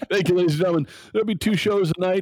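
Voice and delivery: loud, high showman's sign-off; lounge comedian. Pretend. Podcast host mock-signing off after a pun, hammy lounge comedian. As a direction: work the room like a club comic.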